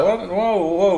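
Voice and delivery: high, startled whoas; retold alarm. Storyteller reacting to his friend's outburst, mock alarm. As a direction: act out being taken aback.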